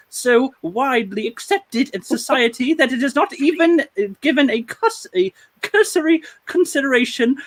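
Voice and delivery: high-pitched hammed-up British accent. Host quoting the cartoonist's protest, mock British voice, high. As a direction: read it in a put-on, high British voice. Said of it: "That is the British voice of the night".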